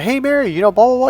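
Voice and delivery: high, loud babbling small talk; hammed-up chirpy blather; mock chatty. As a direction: chatter brightly to provoke dad. Re-enacted chatting to stepmom.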